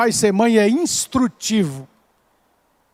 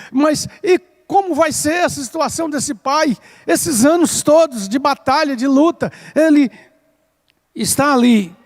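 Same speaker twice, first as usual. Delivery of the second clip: raised-pitch, driving sermon delivery; impassioned preaching; 7 semitones higher than usual. Real, not acted. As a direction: press the congregation with urgent, rolling phrases. Prega com intensidade crescente. (pregação inflamada, em tom elevado)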